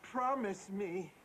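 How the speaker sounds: high, loud, desperate plea; pleading. Acted. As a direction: beg him, frightened, slowing down. Mother fears son's suicide.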